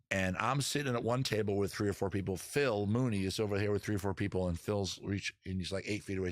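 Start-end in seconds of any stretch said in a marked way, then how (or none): none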